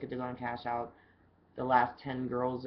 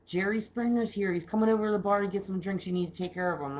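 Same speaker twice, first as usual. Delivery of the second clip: higher, louder, quick excited report; excited news; 6 semitones higher, 5 dB louder than usual. Retold or imitated. Manager telling the bartender a celebrity arrived, excited rush. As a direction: blurt out the big news.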